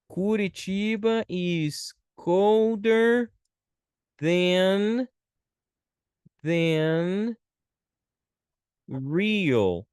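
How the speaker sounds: very slow, stretched words with pauses; slow dictation. Shown as a demonstration. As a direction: dictate it word by word. Teacher writing out example sentence.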